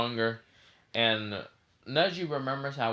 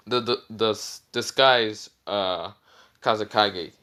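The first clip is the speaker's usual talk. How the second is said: ordinary talk, nothing marked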